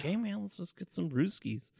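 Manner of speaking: lightly put-on beer-buddy voice, half laughing; Jeffrey Dahmer. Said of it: "that's actually, like, a solid impression".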